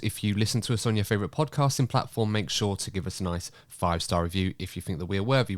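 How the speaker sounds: ordinary talk, nothing marked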